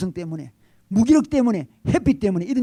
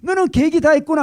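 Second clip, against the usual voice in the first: high, loud repeated catchphrase; admiring exclamation; 8 semitones higher, 6 dB louder than usual. Retold or imitated. Father repeats the famous line, admiring and high. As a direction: quote the father in awe. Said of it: "감탄을 하죠".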